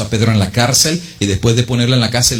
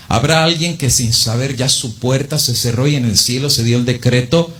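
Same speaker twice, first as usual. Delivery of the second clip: ordinary talk, nothing marked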